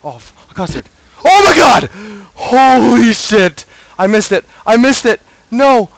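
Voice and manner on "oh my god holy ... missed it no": screams much higher and louder; panicked screaming. Real, not acted. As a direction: yell in real panic at the screen. Missed item, screaming in panic.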